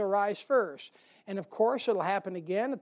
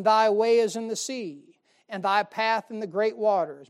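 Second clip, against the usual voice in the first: scripture reading; 6 dB louder than usual. Acted. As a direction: read it out to the flock like holy writ. Preacher reads scripture aloud.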